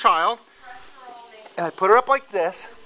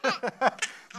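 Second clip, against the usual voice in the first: high laughing teasing; 10 semitones higher than usual. Real, not acted. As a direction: tease her through real laughter. Dad laughing at fake crying.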